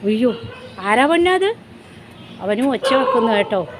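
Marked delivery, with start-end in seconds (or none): 0.8-1.5 higher, with അത് rising at the end; higher sing-song question to a dog; pet talk. Real, not acted. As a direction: coo it to a puppy, pitched up. Cooing to the dog.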